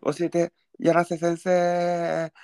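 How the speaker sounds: high, slow jingle-style title call; show title call. Pretend. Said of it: "こういうナレーションチックな時". (番組タイトルコール)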